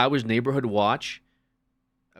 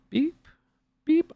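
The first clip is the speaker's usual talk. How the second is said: high-pitched scanner beep; imitated checkout beep. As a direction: mimic a store scanner with a short high beep. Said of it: "It's just like, beep".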